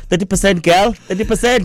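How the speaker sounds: high, incredulous teasing; mock exasperation. Host teasing Stacey about school marks, mock exasperation. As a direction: pitch it way up in mock dismay.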